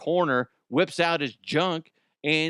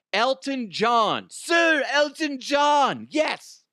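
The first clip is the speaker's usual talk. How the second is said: much higher, slow grand shout; grand announcement; 10 semitones higher, much slower than usual. Pretend. Presenting Sir [name], a slow grand shouted intro. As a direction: announce him like a star entrance.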